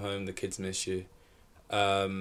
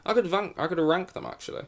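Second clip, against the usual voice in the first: higher, exaggerated posh repeat; 9 semitones higher than usual. Shown as a demonstration. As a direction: overdo the posh accent playfully. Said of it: "I said that in a really posh voice".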